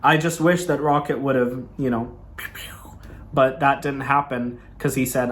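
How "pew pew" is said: soft whispered blaster noise; hushed little "pew pew" shots; imitated gunshots. Fan wishing Rocket had shot the villain, imitated gunshots.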